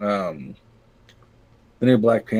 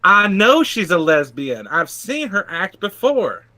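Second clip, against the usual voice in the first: slow, higher-pitched Southern drawl; Southern accent; 8 semitones higher than usual. Acted. Man insisting he knows about an actress, Southern accent. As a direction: draw it out high with a Southern accent. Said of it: "I don't know why he's Southern".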